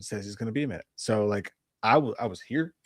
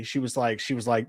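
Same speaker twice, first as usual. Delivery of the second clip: usual speaking voice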